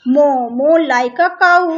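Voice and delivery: loud and drawn out, moo moo; slow loud dictation. Shown as a demonstration. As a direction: call it out slowly to the class. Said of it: "मो किसके sound को बोलते हैं?". (ज़ोर से और धीरे-धीरे)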